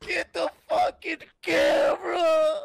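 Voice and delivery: raging gamer. Real, not acted. Streamer raging at game.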